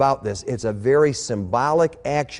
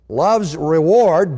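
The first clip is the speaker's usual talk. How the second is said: ordinary talk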